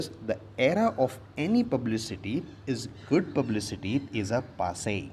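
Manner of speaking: slow, deliberate reading of the sentence; slow classroom reading; slow read-aloud. Shown as a demonstration. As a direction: spell the sentence out slowly for the class. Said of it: "reads the sentence again".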